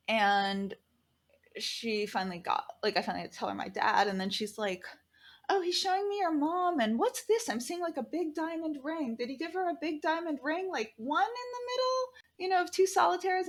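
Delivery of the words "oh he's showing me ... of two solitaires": high, sing-song mock psychic voice; mock the medium fishing for clues; imitating fishing medium. Mocking the fake medium who fished for details. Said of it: "she was very clearly fishing".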